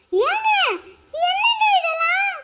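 high, wailing shock; shocked high cry. Acted. Wife confronting her husband at the hotel, high shocked wail.

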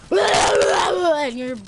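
loud slow yell through laughter; laughing mock yell; laughing shout. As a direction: crack up mid-boast.

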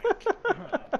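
high, slow exasperated cry; dismayed groan. Real, not acted. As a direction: drag it out in dismay. Reacting to friend hitting wolf.

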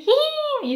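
very high hihin whinny; whinny like a horse. Shown as a demonstration. Teacher praises the Japanese horse sound. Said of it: "is much better".

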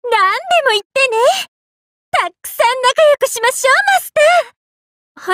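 high, bubbly invitation; exuberant friendliness. Acted. Telling Master to be great friends, exuberant. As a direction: gush happily, drawing out the last word.

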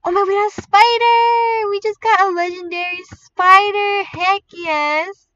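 gasp, then a loud high drawn-out shout; thrilled, celebratory yelling; ecstatic. Real, not acted. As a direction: shriek with joy at a rare win. Wins a legendary spider pet.

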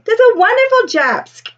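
high, excited crowd voice with a laugh; admiring townsfolk. Acted. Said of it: "they will say".

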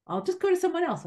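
mock teacher calling me out; quoting her warning; teacher's ultimatum. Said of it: "she said".

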